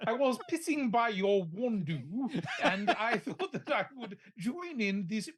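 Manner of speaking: badly mangled French-accented English; comic French accent. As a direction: butcher the vowels in mock French accent. Joins the sitcom French-accent bit.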